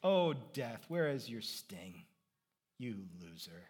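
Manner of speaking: low, understated mocking taunt; playground taunt. Paul taunting death like on a playground, low mocking taunt. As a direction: taunt death like a schoolyard rival, quietly. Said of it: "It's a playground taunt".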